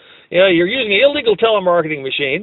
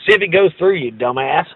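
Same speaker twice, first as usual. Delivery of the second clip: ordinary talk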